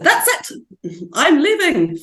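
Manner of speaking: high-pitched imitation of a huffy member quitting; mock fit. Imitating young party members threatening to quit, mock fit. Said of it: "a fit and said".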